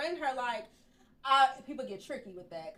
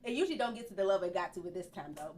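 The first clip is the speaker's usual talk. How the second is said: normal talking voice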